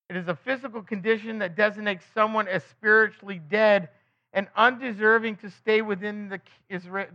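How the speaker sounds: ordinary delivery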